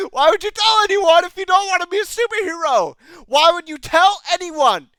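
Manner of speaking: loud, high, incredulous shouting; exasperated outburst. Real, not acted. Reviewer yells at Jen's choice in exasperation. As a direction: yell the question in disbelief.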